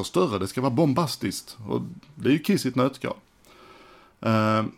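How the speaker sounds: ordinary talk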